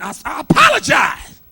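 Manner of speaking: loud, high, wailing apology; overdone sobbing apology; mock tearful apology. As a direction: ham up a crying sorry.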